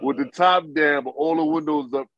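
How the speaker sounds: ordinary talk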